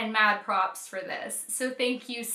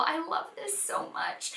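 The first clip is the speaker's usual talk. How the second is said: whispered, almost too moved to speak; 4 semitones higher, 8 dB quieter than usual. Real, not acted. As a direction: breathe the words, touched to the core. Overwhelmed, close to tears.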